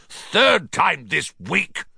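high, slow outraged roar. A narrator's reading. Uncle complaining across the breakfast table, roaring. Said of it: "he roared across the table".

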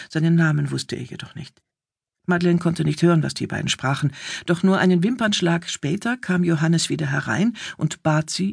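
usual speaking voice

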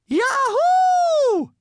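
very high, loud whooping yell; SpongeBob. A narrator's reading. Chosen as hall monitor, yelling in triumph. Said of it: "yelled SpongeBob".

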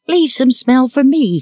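put-on high, drawn-out little girl's order; childish command. A narrator's reading. Little girl wanting her share of cake smell, commanding. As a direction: demand it slowly in a high child voice. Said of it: "commanded Betty".

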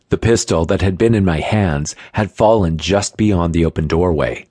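ordinary delivery, nothing marked

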